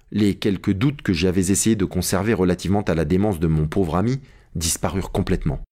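plain reading voice

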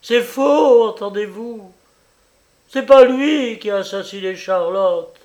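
loud, high, vehement protest; fierce mother defending her son; violent denial. A narrator's reading. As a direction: fling the denial at the judge. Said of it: "dit-elle avec violence".

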